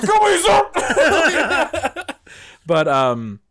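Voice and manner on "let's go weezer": high, stretched-out cheer, laughing; drunken fan holler. Retold or imitated. Drunk fan cheering while the boss fumes, high holler. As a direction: cheer it long and high, oblivious. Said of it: "And I'm just like".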